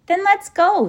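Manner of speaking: put-on high, loud, eager boy. A narrator's reading. Tom urging the others to set off, eagerly. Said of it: "said Tom eagerly".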